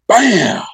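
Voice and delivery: loud, high-pitched "bam"; imitated explosion. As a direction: burst out with a sudden explosion noise. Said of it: "this huge explosion hit".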